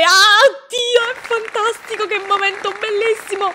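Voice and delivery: thrilled fangirl gush; gushing excitement. Real, not acted. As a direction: swoon over the moment. Fan gushing at big moment.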